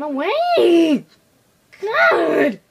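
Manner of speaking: loud, high exasperated yell; frustrated shout. Real, not acted. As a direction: blurt it out in frustration. Outburst at game.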